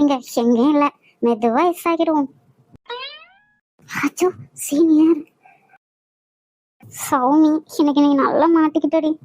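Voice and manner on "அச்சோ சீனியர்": slow, breathless whisper of alarm; whispered gasp on spotting him; frightened whisper. Acted. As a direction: hiss it so the senior won't hear. Spotting the feared senior.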